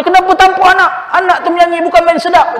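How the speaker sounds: high, loud voice of an upset mother; mock upset mother. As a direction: play the mother scolding her husband. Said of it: "Mak tanya".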